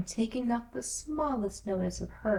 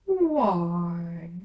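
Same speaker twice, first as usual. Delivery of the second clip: caterpillar voice; 6 dB louder than usual. A narrator's reading. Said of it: "said the caterpillar".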